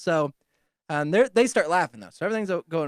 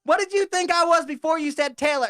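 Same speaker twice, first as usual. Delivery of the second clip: high, raised heckling voice; mock yelling; 11 semitones higher, 4 dB louder than usual. Diners yelling questions at the man eating alone, mock yell. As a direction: yell it across in a put-on high voice. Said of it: "they're just yelling at me".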